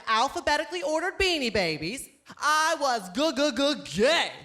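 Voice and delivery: ordinary talk, nothing marked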